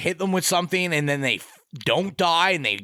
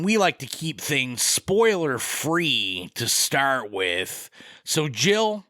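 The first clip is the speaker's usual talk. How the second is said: ordinary delivery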